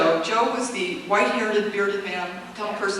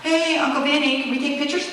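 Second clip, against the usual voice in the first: put-on higher, playful kid voice with a laugh at the end; cheeky kid; 6 semitones higher, 3 dB louder than usual. Tunnel rats pestering Uncle Benny on set, cheeky kid voice. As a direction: wheedle like a mischievous child. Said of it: "cast kids pestering the crew".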